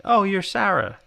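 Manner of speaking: high-pitched retold recognition; sudden recognition. Man greeting girl known from Facebook, pleased recognition. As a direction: pipe up as if spotting someone known.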